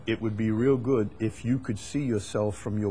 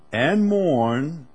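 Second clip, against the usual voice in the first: emphatic command; 6 semitones higher, 10 dB louder than usual. Acted. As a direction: mourn hit high and loud. Imperative scripture, emphatic.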